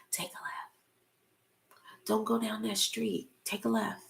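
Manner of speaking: hushed whisper of an inner voice; soft whispered guidance. Acted. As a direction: breathe it like a quiet inner nudge. Inner still voice quoted softly.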